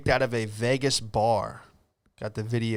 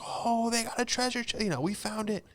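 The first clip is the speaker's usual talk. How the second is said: put-on high, thrilled child voice; a kid's breathless joy at finding treasure; excited kid; 11 semitones higher, 5 dB quieter than usual. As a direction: squeal it like a kid who found treasure. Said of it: "I was like".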